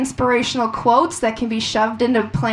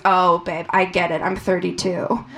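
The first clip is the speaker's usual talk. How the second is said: reassuring doctor; 6 semitones lower than usual. Retold or imitated. Doctor's reply voiced in story.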